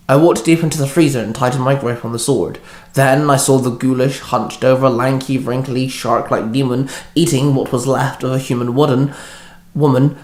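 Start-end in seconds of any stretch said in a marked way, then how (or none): none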